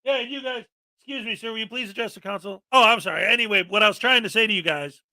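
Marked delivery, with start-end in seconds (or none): 0.1-2.6 quick, much softer polite request; polite calm human. Retold or imitated. Council chair asking a speaker to face the council, softly. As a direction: ask it gently and hurry on, politely. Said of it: "what would a human do?".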